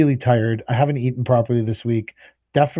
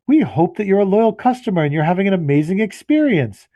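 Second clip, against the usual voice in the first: higher, syrupy recorded-message voice; mock hold message; 6 semitones higher than usual. Caller mocking the hold line's recorded message, sugary. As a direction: parody the cheesy hold recording.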